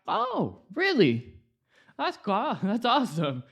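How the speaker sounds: excited surprise. Retold or imitated. Told they want him back.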